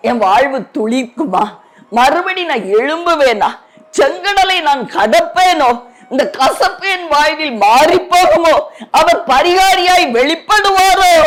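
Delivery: questions climbing to a high cry; pleading lament. Acted.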